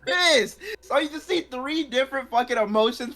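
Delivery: excited. Real, not acted. Excited recap of Seth's reaction.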